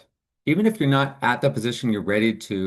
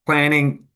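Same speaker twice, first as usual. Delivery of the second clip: "planning" said higher and louder, set apart; stressing planning; 5 semitones higher, 5 dB louder than usual. Real, not acted. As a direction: lean hard on "planning" as the key word. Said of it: "I stress the word planning".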